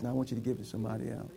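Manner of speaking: quick, low, quiet quoted request; gentle instruction. Retold or imitated. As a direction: tell them softly and quickly. Acted gentle instruction to receivers.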